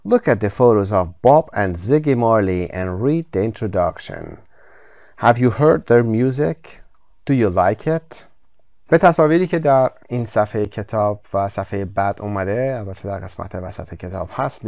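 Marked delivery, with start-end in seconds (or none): 0.0-8.2 slow, clear reading of the instructions; slow textbook reading. Shown as a demonstration. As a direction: read the rubric out slowly for learners. Teacher reads textbook instructions.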